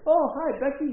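high, loud, eager acted hello; friendly greeting. Art meets the intern properly, acted delighted greeting. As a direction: greet her with sudden delight.

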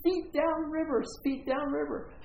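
high, urgent retold yell. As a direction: shout the instruction across the whitewater. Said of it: "he's yelling at me".

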